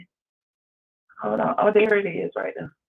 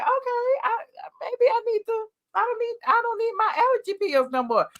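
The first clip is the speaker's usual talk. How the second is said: quoting her past self much higher, light and breezy; mock inner voice; 11 semitones higher than usual. Host mimicking herself quitting allergy pills, higher and carefree. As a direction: play your overconfident past self. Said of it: "it's been like".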